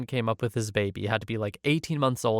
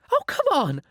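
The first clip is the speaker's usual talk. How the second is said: high, shrieky complaint from an irate guest; very high-pitched customer outburst; outraged scream; 4 dB louder than usual. A narrator's reading. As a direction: scream it high in indignant disbelief. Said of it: "She screams".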